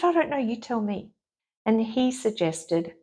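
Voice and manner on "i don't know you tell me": high, pushy burst then dropping; throwing it back at the doctor; exasperated. Retold or imitated. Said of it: "I said".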